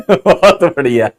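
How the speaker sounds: laughing through the words, slowed; breaking up with laughter while praising; real amused laugh. Real, not acted. Laughing at student's joke.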